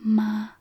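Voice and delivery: "Ma" held on one level pitch; first tone. Shown as a demonstration. Teacher showing Mandarin first tone, level "Ma". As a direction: say "Ma" with a steady, even tone. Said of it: "the first tone".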